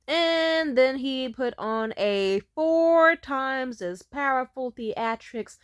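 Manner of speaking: very slow, loud, stretched-out words; emphatic exaggeration. Real, not acted. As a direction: drag out each word for dramatic scale. Dramatizing the tantrum's scale.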